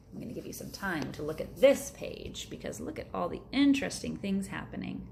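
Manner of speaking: ordinary delivery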